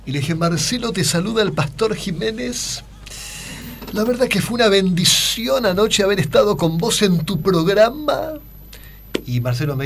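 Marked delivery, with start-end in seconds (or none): 0.3-8.3 high, slow preacher voice, put on for the phone call; slow and high, playing the pastor; Pastor Jiménez impression. Calling the TV host as the pastor, high slow preacher voice. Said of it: "la imitación del Pastor Jiménez".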